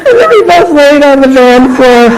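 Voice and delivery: ordinary talk, nothing marked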